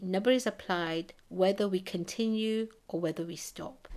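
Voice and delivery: usual speaking voice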